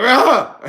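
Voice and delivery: loud, high waking-up scream; hammed-up panicked cry; startled yelp. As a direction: yelp as if jolted awake late. Acting out waking up late.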